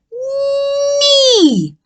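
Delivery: "knee" with a humming nasal n, high. Shown as a demonstration. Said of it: "use my nose sound too".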